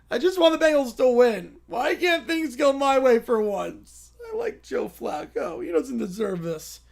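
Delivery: put-on whining kid. Spoiled kid whining about building the new planet. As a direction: whine like a pampered brat who won't pack. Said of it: "someone puts starts whining again".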